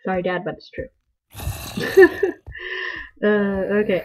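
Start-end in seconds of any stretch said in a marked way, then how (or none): 1.4-3.2 high giggle; amused, high-pitched laughter. Real, not acted. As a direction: giggle after teasing someone. Laughs after teasing dad.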